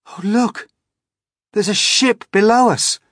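put-on high, loud boyish shout of discovery; shouting excitedly. A narrator's reading. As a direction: call out the ship below, thrilled. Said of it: "shouted James".

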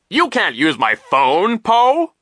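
high, loud, drawn-out refusal; slow, indignant, high-pitched character voice; petulant vice principal. A narrator's reading. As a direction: refuse pompously with swooping pitch. Nero angrily refuses Poe.

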